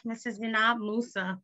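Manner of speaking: usual speaking voice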